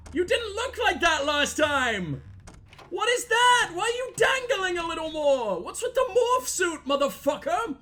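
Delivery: high-pitched, alarmed protest; panicked outcry. Real, not acted. Player confronting the changed monster, panicked high voice. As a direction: voice shoots way up in freaked-out disbelief.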